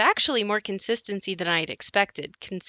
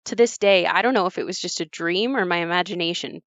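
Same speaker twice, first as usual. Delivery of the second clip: ordinary talk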